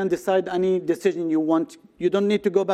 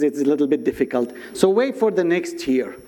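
usual speaking voice

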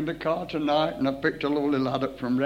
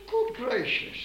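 surprised; slower than usual. Retold or imitated. Retold shocked reply.

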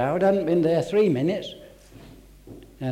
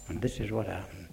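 ordinary talk

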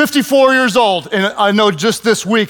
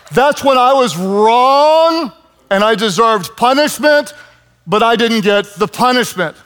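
ordinary talk, nothing marked

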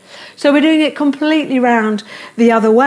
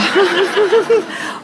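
high laugh; 9 semitones higher than usual. Real, not acted. Laughs mid-joke.

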